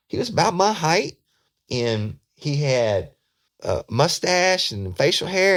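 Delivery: high, slow put-on voice; excited. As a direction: drag the description out eagerly. Suspect describes the man.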